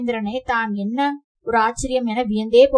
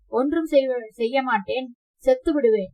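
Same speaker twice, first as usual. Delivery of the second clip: ordinary delivery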